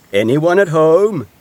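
loud, high, drawn-out call. A narrator's reading. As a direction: call up to a friend's house, stretching it out. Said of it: "called Badger".

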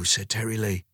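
usual speaking voice

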